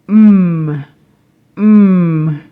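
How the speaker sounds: "Mm" as a held hum on closed lips; humming consonant sound. Shown as a demonstration. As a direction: hum "mm" through the nose. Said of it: "as in man".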